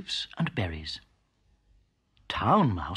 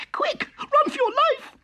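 very high, louder panicked squeak; terrified squeak; 5 dB louder than usual. A narrator's reading. Town mouse warning her cousin about the cat, very high panicked squeak. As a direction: shriek a warning as the cat leaps. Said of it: "squeaked Town Mouse".

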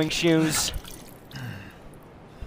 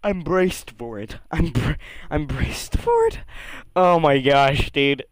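ordinary talk

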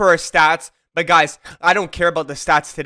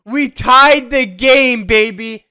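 celebratory shout; 10 semitones higher, 7 dB louder, slower than usual. Real, not acted. Tying the game celebration.

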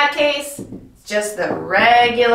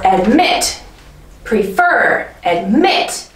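"admit. Prefer, admit." with MIT and FER punched, rising; stress on second syllable. Shown as a demonstration. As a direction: lift and lean on the last syllable of "admit" and "Prefer". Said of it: "Emphasis is on the second syllable".

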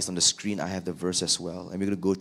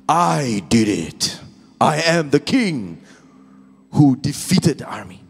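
high, loud, slow swagger; boastful Saul; 5 semitones higher, 8 dB louder, slower than usual. Acted. Saul boasting he is king, proud and loud. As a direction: crow over the victory.

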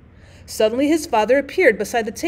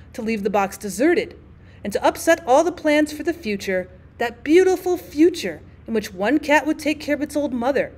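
ordinary delivery, nothing marked

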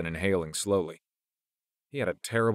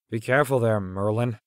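lightly teasing, pitched-up warning; mock gently with raised pitch; 4 semitones higher, 4 dB louder than usual. A narrator's reading. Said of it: "Marcus mocked".

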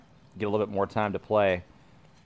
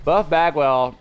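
loud, higher ring-announcer name call; 5 semitones higher, 6 dB louder, slower than usual. Acted. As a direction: belt the name out like a wrestling announcer. Wrestling-announcer style name call.

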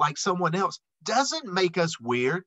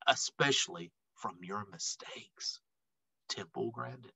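soft whisper, much lower; 8 semitones lower, 11 dB quieter than usual. A narrator's reading. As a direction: let it fall to a whisper. Closing line of letter.

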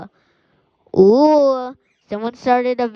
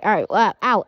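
normal talking voice

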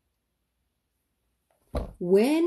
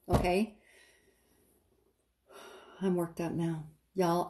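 normal talking voice

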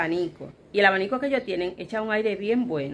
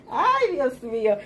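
amused sigh; 3 semitones higher, much slower than usual. Real, not acted. After laughing.

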